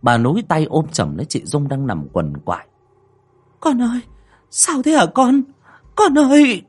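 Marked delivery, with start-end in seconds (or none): 3.6-6.6 high, alarmed cry growing louder; panicked motherly cry. Acted. Mother holding writhing Dung, panicked. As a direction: call out in alarm to a stricken child.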